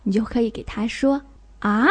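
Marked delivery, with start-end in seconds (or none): 1.6-1.9 short rising, doubting "huh?"; puzzled question. Shown as a demonstration. Teacher showing how to voice confusion, questioning. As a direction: query it, puzzled. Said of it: "对什么东西产生疑惑".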